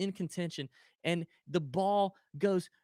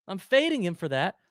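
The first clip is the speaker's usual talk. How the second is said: usual speaking voice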